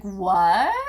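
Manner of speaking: surprised what. Retold or imitated. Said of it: "I was like".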